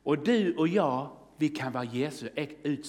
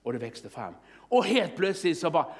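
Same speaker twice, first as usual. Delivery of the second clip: usual speaking voice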